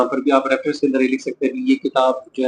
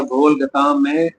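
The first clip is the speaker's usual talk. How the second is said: slow, measured verse recitation; recited couplet; 4 dB louder, slower than usual. Shown as a demonstration. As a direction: read the line slowly so students can write it. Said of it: "आपने कहा". (धीमी शायराना अदायगी)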